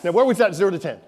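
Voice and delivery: usual speaking voice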